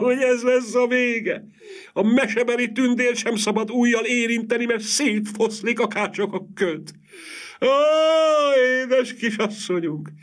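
acted shrieking grief, wringing every phrase; loud wailing. A narrator's reading. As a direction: wail like a distraught village woman. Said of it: "legjobban jajveszékelt Adamecné a kezeit tördelve".

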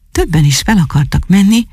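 ordinary delivery, nothing marked

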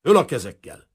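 detective's sharp command at gunpoint; armed command. A narrator's reading. Detective aiming revolver at Kazimír, commanding.

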